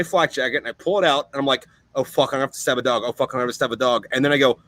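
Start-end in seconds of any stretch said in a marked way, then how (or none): 1.9-4.1 retold dread. Marine realising he must knife a charging dog, dreading it. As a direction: rush it out in reluctant, resigned repetition. Retelling his dread at the attack.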